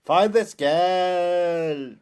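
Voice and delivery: hammy villain order. Pretend. Quoting villain's command.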